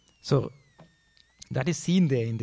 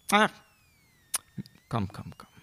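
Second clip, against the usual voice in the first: tender welcome; 3 semitones lower than usual. Pretend. Bhagavan welcoming the crying devotee.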